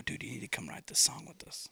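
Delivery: hushed, half-whispered urging; whispering to the roommate about the new singer; retold whisper. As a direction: lean in and whisper it excitedly.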